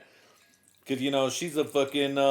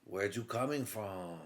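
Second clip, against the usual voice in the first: mock Mexican accent; 4 semitones lower, 8 dB quieter than usual. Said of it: "Mexican, no lie".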